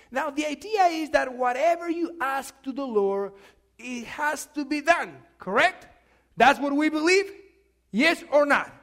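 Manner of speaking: loud, rising preacher voice; preaching. Acted. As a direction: preach it out to the congregation. Preacher building to full voice.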